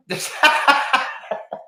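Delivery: high-pitched breathy giggle; high breathy laugh. Real, not acted. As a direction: giggle at your own joke. Laughs at own luck.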